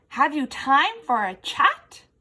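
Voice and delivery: loud booming invitation. Acted. Effie asks the beetle to chat, booming. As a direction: boom the friendly question. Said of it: "Effie's voice was like thunder".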